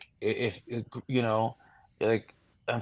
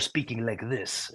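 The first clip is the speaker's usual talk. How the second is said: dropped octave; 9 dB quieter than usual. Shown as a demonstration. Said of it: "I drop an octave".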